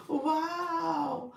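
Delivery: squealing high gasp of amazement; can't believe the news, high and thrilled; shocked delight. Real, not acted. Host gasping with joy at a viewer's one K milestone.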